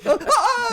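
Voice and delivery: high laughing mock scream of pain. Elf being tortured by Melkor, high laughing shriek. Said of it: "This guy's like".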